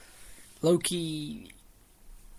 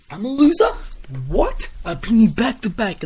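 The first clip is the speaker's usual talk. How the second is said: much higher, much louder indignant yell; outraged disbelief; 9 semitones higher, 10 dB louder, slower than usual. Retold or imitated. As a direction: shout back in disbelief at the insult. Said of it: "Then Bobby goes".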